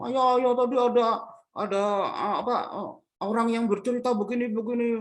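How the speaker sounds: put-on high, loud whiny child complaining to father; whiny sulking. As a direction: whine like a spoiled daughter. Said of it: "merajuk gitu". (anak merajuk pada ayahnya dengan suara tinggi)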